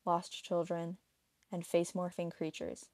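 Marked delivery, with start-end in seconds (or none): none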